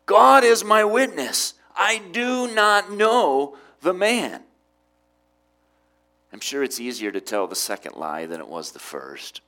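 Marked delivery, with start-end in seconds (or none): 0.1-4.4 slow, high, loud declaration; solemn sworn oath. Acted. Peter denying Jesus under oath, sworn loudly and slowly. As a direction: swear it forcefully, stretching the words out. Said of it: "denies knowing Jesus with an oath".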